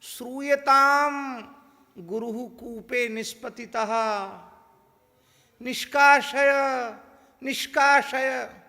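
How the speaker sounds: very high, loud, drawn-out Sanskrit cry; student yelling in Sanskrit that the guru fell in the well; shouting for help. Retold or imitated. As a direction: holler across the forest for help. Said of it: "वो चिल्लाकर बोला".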